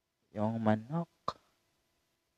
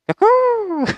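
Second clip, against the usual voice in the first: very high, loud bird cry "cuckoo"; cuckoo call; 13 dB louder than usual. Shown as a demonstration. Vlogger imitating the English rooster, very high. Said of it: "the English one is".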